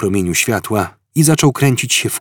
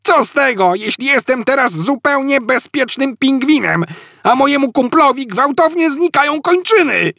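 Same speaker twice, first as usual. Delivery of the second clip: much higher, indignant penguin rant; furious rant. Acted. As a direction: rage at the absurd comfort. Ford ranting as a penguin.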